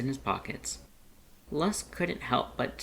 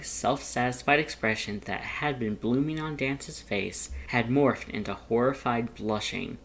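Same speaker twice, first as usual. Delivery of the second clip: ordinary delivery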